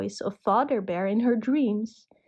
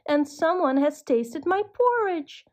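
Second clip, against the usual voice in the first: higher, lightly squeaky little bear; high-pitched cry; 7 semitones higher than usual. A narrator's reading. Baby Bear finds his porridge eaten, crying out high. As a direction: wail it like a small upset cub. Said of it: "cried Baby Bear in his high-pitched voice".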